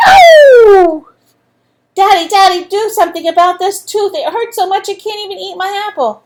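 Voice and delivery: put-on high, loud yelling child in pain; yelling boy. A narrator's reading. Andrew yelling to his mom about his tooth, loud and high. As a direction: shout it like a kid with a sore tooth. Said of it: "And he yelled".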